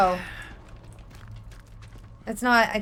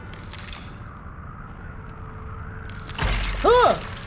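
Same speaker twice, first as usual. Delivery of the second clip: 1 sharp gasp; 7 semitones higher, 4 dB quieter than usual. Real, not acted. Startled by noise in game.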